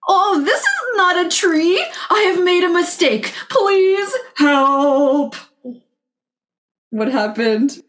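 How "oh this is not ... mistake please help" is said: high, slow, worried little dinosaur; alarmed cry for help. Acted. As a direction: plead for help, voice lifted high. Tiny T-Rex realizes mistake.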